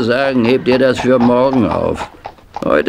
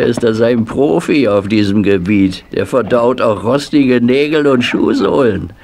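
ordinary talk, nothing marked

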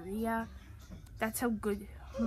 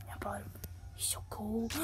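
soft admiring whisper; hushed admiration; whispered aside; 3 semitones lower, 6 dB quieter than usual. Real, not acted. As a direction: breathe it quietly.

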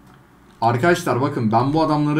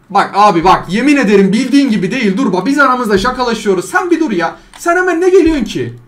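1 loud pleading; 7 semitones higher, 7 dB louder than usual. Real, not acted.

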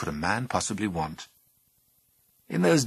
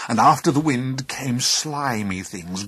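plain reading voice

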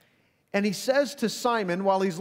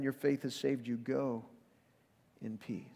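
soft blessing; 5 semitones lower, 8 dB quieter than usual. Acted. Jesus sends her away.

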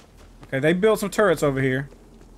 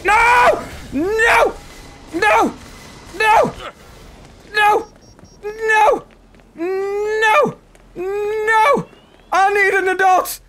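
panicked screaming; 13 semitones higher, much slower than usual. Real, not acted. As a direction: scream like you're about to die. Panicking while being chased.

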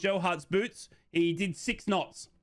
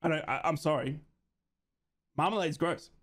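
ordinary talk, nothing marked

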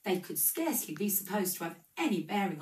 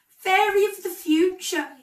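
high, loud cry to the spirit; exclaiming; 13 semitones higher, 11 dB louder than usual. A narrator's reading. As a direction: call out to the ghost, much higher and louder. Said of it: "he exclaimed".

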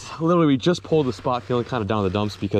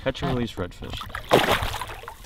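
slow, whispered caption; hushed slow whisper; 3 dB quieter, much slower than usual. Real, not acted. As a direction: whisper it slowly as the fish goes. Whispering while releasing fish.

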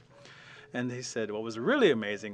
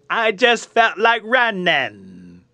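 loud, high, very drawn-out drawl; comic film-character impression, stretched to the limit; Forrest Gump; 10 semitones higher, 17 dB louder, much slower than usual. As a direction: belt the punchline out slowly. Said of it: "if you've seen Forrest Gump".

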